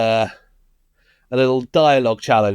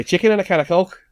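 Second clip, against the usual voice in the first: raised-pitch Scouse catchphrase; Scouse accent; 5 semitones higher than usual. Shown as a demonstration. Host prompts co-host to repeat it, Scouse accent. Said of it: "to get into the Scouse accent".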